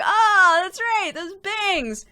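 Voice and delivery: high, wistful remembering; high nostalgic sigh. Retold or imitated. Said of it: "I'm like".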